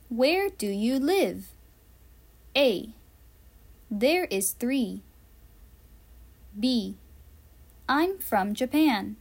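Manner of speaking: usual speaking voice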